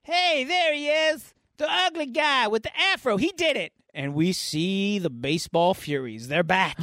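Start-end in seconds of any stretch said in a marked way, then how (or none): 0.0-3.7 high-pitched accusing call from off screen; mock calling out. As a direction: imitate someone crying out an accusation. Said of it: "Then we hear off screen".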